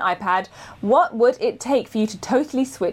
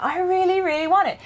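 excited longing; 9 semitones higher than usual. Retold or imitated. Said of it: "And I was like".

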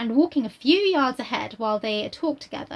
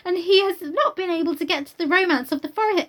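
put-on higher, breathless gushing girl; eager Harriet; 7 semitones higher than usual. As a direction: gush it out like an excited young girl. Reads Harriet's lines aloud.

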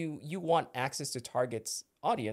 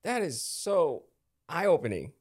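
normal talking voice

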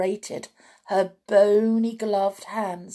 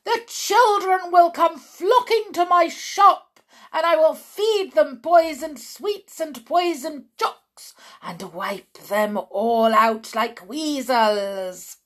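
much higher, loud gleeful witch shout; excited shout; 8 semitones higher, 8 dB louder than usual. A narrator's reading. Foolish witch blurting her plan, high excited shout. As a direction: shriek it with wicked glee. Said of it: "leapt to her feet and shouted".

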